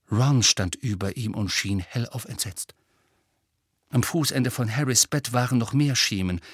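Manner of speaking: plain reading voice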